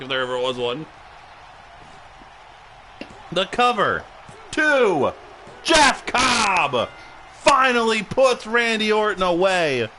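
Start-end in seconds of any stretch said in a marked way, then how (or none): 3.3-9.9 louder call of the pin; excited call. Acted. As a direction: shout the finish live.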